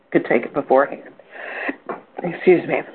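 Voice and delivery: talking through hiccups; hiccupy, interrupted words. Real, not acted. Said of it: "Got the hiccups a little bit this morning".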